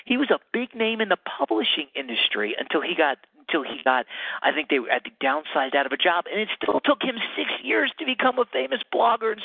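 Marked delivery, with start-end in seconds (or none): none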